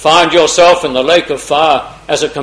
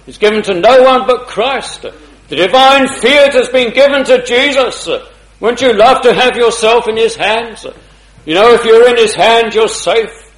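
higher, louder, driving preacher voice; impassioned preaching; 7 semitones higher, 5 dB louder than usual. Acted. Preacher urging the congregation, impassioned. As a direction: press each "safe" home.

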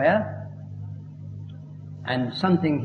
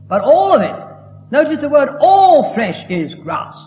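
fiery preaching; 9 semitones higher, 9 dB louder than usual. Acted. Preacher hammering the key verse.

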